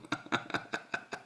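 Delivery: soft breathy chuckle; low-volume voiceless laughter; teasing laugh. Real, not acted. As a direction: chuckle after a playful jab. Laughs after teasing the audience.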